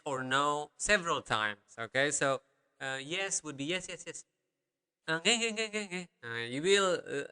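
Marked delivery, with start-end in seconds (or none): 3.6-4.2 repeated yes. Shown as a demonstration. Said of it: "we usually tend to repeat". 5.2-6.1 higher, rattled-off string of agreement; quick acted demo of agreeing; repeated polite yes. Shown as a demonstration. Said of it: "we usually tend to repeat".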